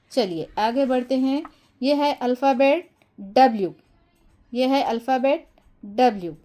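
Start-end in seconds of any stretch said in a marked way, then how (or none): none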